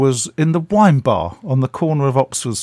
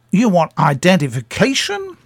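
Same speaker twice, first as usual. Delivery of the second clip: indignant disbelief; 6 semitones higher, 3 dB louder than usual. Retold or imitated. Star indignant at being asked.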